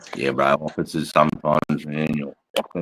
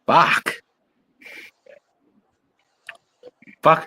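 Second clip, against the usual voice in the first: shocked curse; 10 semitones higher than usual. Real, not acted. Shocked by news of the lease.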